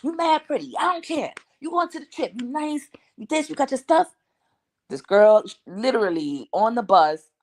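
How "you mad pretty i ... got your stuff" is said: imitating the boy, much higher and egging her on; mock him hyping her up to go; high, pushy imitation of her younger brother; hyped-up boy. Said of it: "He was like".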